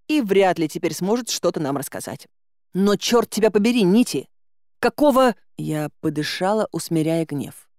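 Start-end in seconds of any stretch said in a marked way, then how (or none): 2.7-5.4 angry. A narrator's reading. Angry outburst at mentor.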